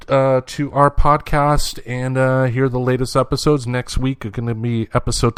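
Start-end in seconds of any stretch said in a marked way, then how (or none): none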